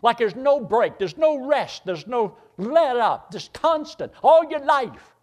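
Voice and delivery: high, loud, slow preacher's climax; preaching at full force. Acted. Preacher pressing the congregation on endless hardship, loud and high.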